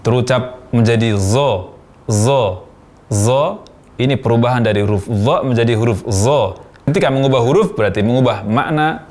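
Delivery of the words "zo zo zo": "zo" said with a buzzing z in place of dha; "zo" as a wrong dha, made as a plain z; dha as zai. Shown as a demonstration. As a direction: demonstrate the mistake, "zo" from the z position. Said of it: "Kesalahan pertama, mengucapkan huruf dha dari posisi huruf zai".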